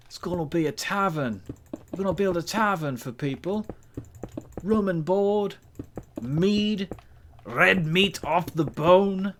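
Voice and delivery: high, loud mock-Scottish tavern patter; attempted Scottish accent. Streamer pitching a tavern to people, attempted Scottish accent. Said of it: "I can't do the Scottish accent".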